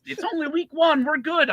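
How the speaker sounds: mock upbeat Joey; mimicking Joey. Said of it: "Joey in the background, like".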